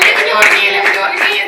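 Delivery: excited pleading. Retold or imitated. Woman asking counselor again, loud excited plea. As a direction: beg for another go, thrilled. Eager repeat request, loud.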